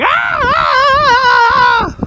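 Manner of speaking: long high-pitched mock scream. Acting out the athlete who starts screaming, high scream. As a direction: let out a drawn-out piercing scream, imitating him. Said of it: "and he starts screaming".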